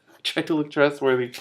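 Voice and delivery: laughing through the retake of the line; giggling, can't keep it straight. Shown as a demonstration. Narrator laughing while rereading her own line.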